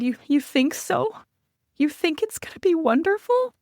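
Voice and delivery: high, tearful "you think so", lightly acted; mock teary question. Wife asking husband through tears, mock-teary and high. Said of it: "through my tears".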